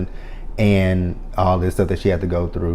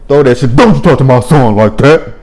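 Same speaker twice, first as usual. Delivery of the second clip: louder, higher, defending her son; mock angry mother; 4 semitones higher, 7 dB louder than usual. Mother voiced scolding her son's accuser, angry and loud. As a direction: bark it like a furious mama. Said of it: "No Man Pam just say".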